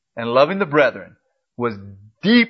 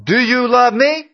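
much higher and louder, rising question; preacher challenging the church, much higher and louder; pressing challenge; 10 semitones higher, 4 dB louder than usual. Acted. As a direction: throw the question hard at the congregation. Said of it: "Jesus took this direct line".

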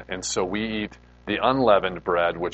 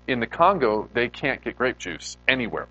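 ordinary delivery

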